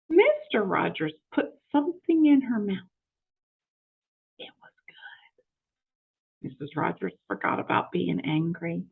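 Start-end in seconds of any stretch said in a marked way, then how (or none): 4.4-6.5 slow breathless whisper; hushed with delight at the pie. Acted. Tasting the pie.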